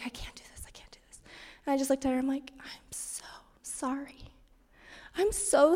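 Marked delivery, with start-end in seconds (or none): 0.0-1.2 barely audible whisper, to herself; whispered dread. Real, not acted. Dreading apologizing to her daughter. 2.6-4.3 slow, soft whisper; tender whisper. Real, not acted. Apologizing to her crying daughter.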